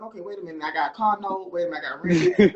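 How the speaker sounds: low-pitched reasoning with oneself; sobering self-talk. Retold or imitated. Speaker recalls thinking of car note and rent, low self-reasoning. Acting out own sobering thoughts.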